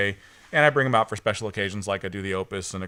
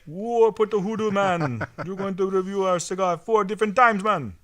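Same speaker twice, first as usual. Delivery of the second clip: high, slow, loose mock Jamaican accent; lilting put-on Jamaican accent, hammed up; bad mock Jamaican accent; 9 semitones higher, 4 dB louder than usual. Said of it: "making him sound Jamaican".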